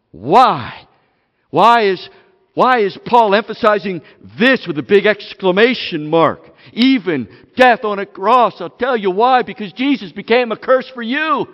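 loud, pounding preacher's voice; fiery sermon build; full-force preaching. Acted. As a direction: hammer each line home to the room.

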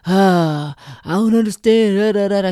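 loud, high whiny mock of a child's backtalk; hammed-up teenage backtalk; mocking a sulky kid. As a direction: mimic the kid's attitude, loud and whiny. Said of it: "take the attitude and be like".

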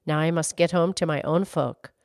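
low-pitched storybook horse. A narrator's reading. Horse leaving the girl to go home, low voice. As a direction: take leave in a low horse voice.

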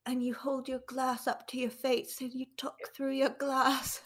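jaw jutted forward, talking through it; underbite. Shown as a demonstration. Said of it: "she was doing the, like, talking through your jaw thing that Keira Knightley does".

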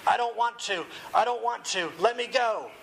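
acted distressed pleading; groaning. A narrator's reading. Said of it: "he groaned".

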